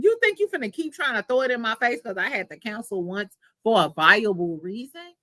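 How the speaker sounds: ordinary talk, nothing marked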